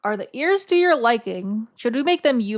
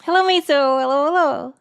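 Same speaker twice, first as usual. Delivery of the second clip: cooing greeting; 5 semitones higher, 5 dB louder than usual. Real, not acted. Greets Miso, interrupting work.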